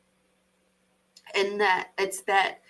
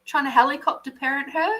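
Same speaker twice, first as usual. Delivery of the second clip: ordinary talk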